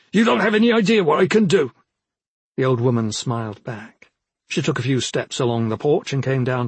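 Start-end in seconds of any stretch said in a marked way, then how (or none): 0.1-1.7 high, loud, rapid threat; angry, rushed and raised; infuriated threat. A narrator's reading. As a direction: fire off a furious warning. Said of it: "Suddenly Tavo was infuriated".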